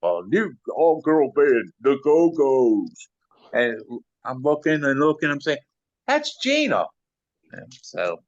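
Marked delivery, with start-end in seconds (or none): 0.0-3.1 slow, higher announcer voice on TV; drawn out like a music channel promo. Retold or imitated. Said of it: "I'm watching M T V and I hear". 6.1-6.9 surprised recognition. Retold or imitated. Recognizes friend on TV.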